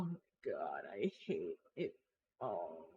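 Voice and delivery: deep, slow groaning. Real, not acted. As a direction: groan it out from the gut, very low. Groaning about ugly icons.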